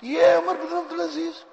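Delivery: high amazed cry; can this really be him. Acted. Widow learns the worker is the caliph, high and amazed. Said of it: "تو وہ عورت بڑی حیران ہے".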